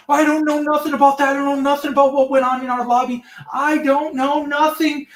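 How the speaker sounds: high-pitched put-on denial, rushing then slowing; mock defensive dispatcher. Dispatcher denying knowledge of what went on in lobby, mock defensive dispatcher. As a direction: imitate a stonewalling dispatcher in a high voice.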